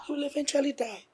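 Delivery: insist, lifting the word "eventually" sharply; heightened insistence. Acted. Wife repeats it more insistently.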